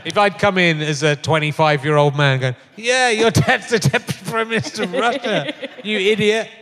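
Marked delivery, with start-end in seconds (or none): 2.8-6.5 laughing through a cheeky put-down, pitched higher; mock young man, laughing. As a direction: tease him with a laugh in the voice. Imagined young man mocking dad.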